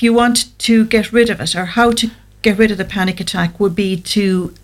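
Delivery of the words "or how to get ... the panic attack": ordinary talk, nothing marked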